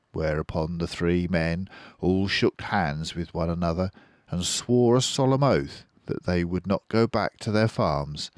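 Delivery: ordinary delivery, nothing marked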